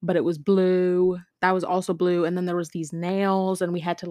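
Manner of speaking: ordinary talk, nothing marked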